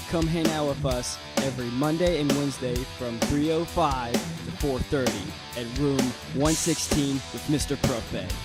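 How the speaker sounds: ordinary talk, nothing marked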